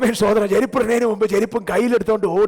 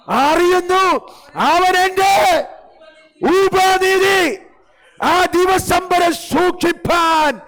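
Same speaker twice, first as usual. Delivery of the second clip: slow, loud and high, each word hammered out; booming scripture proclamation; 9 semitones higher, 4 dB louder, much slower than usual. Acted. Preacher proclaiming the verse to the church, booming and slow. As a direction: thunder the verse over the congregation.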